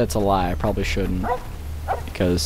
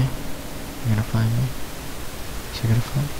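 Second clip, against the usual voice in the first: hushed; 9 dB quieter, faster than usual. Real, not acted. Hiding behind a rock.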